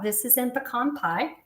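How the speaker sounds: ordinary talk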